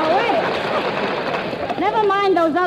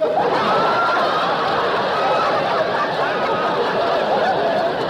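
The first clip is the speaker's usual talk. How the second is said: big delighted laugh. Real, not acted. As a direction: burst out laughing.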